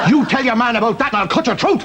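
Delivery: high, urgent mock threat; threatening. Farmer threatening the hen that saw him, menacing. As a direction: warn the witness off in high panic.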